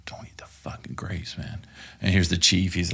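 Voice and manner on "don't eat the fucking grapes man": whispered warning across the table; urgent whisper. Retold or imitated. Whispering to partner Jeff during the meeting, urgent whisper. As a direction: hiss it so the colonel can't hear.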